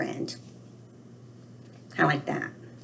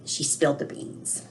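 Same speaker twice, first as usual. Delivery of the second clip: whispered; 4 semitones lower, 8 dB quieter than usual. Shown as a demonstration. Teacher repeats idiom softly.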